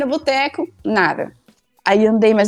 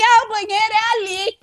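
mock shouted answer; 10 semitones higher than usual. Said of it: "gritou pra biblioteca inteira".

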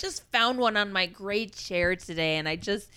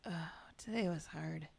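soft, breathless whisper of exhaustion; weary whisper; 6 semitones lower, 11 dB quieter than usual. Acted. Tired mom thanks her cohost, weary whisper. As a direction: whisper like you are worn out.

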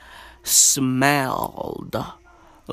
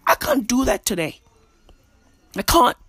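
ordinary talk, nothing marked

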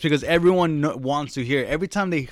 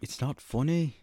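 soft mock-British child voice; lightly put-on British accent; little British girl; 2 semitones higher, 8 dB quieter than usual. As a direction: quietly mimic the British girl. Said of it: "and we say it".

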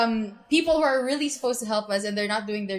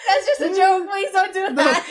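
laughing; 8 semitones higher, 3 dB louder than usual. Real, not acted. Laughing at a dark joke.

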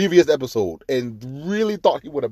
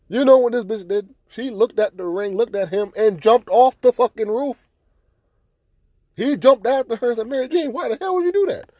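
higher, louder, indignant storytelling; outraged retelling; 10 semitones higher, 4 dB louder than usual. Real, not acted. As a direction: tell it like you can't believe she did it. Outraged retelling of Mary Jane.

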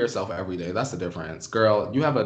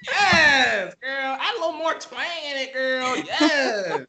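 high-pitched gleeful cheering; delighted high-pitched egging-on; excited hyping; 3 dB louder, slower than usual. Real, not acted. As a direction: hype your friend up, squealing approval. Hyping up friend's voiceover attempt.

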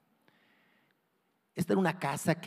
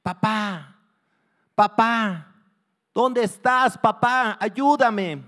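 put-on high, loud cry of a trapped child; desperate child's yell; shouting for help; 7 semitones higher, 10 dB louder, much slower than usual. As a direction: scream for your father to save you. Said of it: "empezó a gritar".

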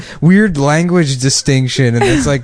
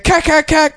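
imitated gunfire. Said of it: "he's like".

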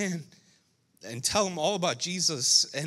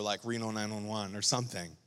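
ordinary talk, nothing marked

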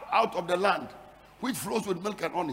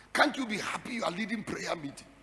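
usual speaking voice

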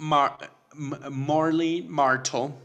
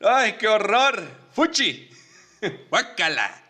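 disgusted squeal; 8 semitones higher, slower than usual. Retold or imitated. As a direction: shriek in mock disgust. Se transforman con asco. (gritos agudos de asco fingido)

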